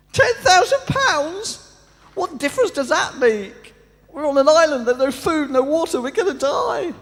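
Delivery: castaway shrieking back at the calm millionaire; shrieking disbelief. Retold or imitated. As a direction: shriek it, he can't believe it. First man shrieking in disbelief.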